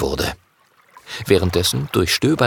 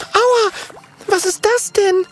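startled cry. Acted. Mathilda cries out hitting something while digging. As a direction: cry out as something hard hits you. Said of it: "Plötzlich schreit Mathilda auf".

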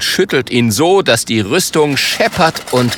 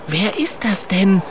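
leicht gespielte, höhere Frauenstimme; fragende Mathilda; 8 semitones higher than usual. Acted. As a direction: frag mit hellerer Figurenstimme nach. Mathilda fragt neugierig nach. (leicht gespielte, höhere Mädchenstimme)

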